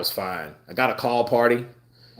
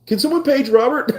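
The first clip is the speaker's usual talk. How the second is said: high, louder mock call for Robert; 5 dB louder than usual. Imitating staff searching the store, high mock shout. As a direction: shout the name up high, hammed-up. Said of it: "I guess that stands for Robert".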